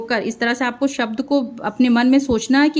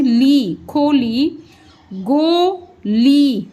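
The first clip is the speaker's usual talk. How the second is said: slow sounding out; much slower than usual. Shown as a demonstration. Teacher sounding out गोली slowly for pupils. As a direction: stretch गोली out very slowly, syllable by syllable. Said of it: "ई का sound मिला इसमें".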